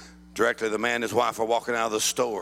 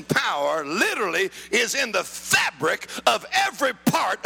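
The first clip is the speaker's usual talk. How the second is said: full-force preaching; 11 semitones higher, slower than usual. Real, not acted. Preacher declares resurrection power to the congregation, full-force preaching. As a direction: preach it in emphatic, driving phrases.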